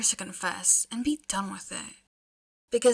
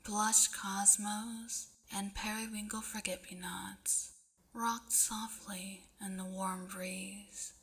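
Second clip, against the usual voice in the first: hushed, slow storybook whisper; whispered narration; 11 dB quieter, slower than usual. A narrator's reading. Reader whispering a dream sequence to the listener, hushed. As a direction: breathe the dreamy scene out softly.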